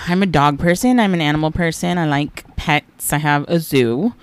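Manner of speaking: ordinary talk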